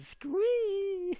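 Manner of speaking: very high, loud hammed-up squee; mock fan squeal. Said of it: "Spong said".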